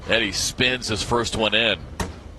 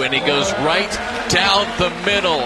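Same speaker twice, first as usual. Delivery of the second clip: rising, louder play-by-play call; building excitement; 8 semitones higher, 4 dB louder than usual. Real, not acted. Commentator calling the game down to the wire, building excitement. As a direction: build the call toward the finish.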